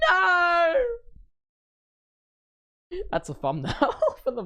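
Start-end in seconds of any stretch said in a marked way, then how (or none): 0.1-0.7 loud high wail of despair; mock anguished shout. Streamer despairing at four am starts, loud wailing no. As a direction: howl NO like all is lost. Howls over early start.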